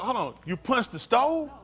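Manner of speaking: ordinary talk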